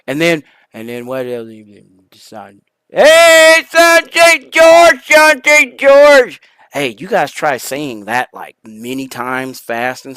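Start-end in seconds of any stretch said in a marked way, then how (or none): 2.9-6.4 slow, loud and high name call; drawn-out announcement. Acted. Host calling out a guest's name, slow and loud.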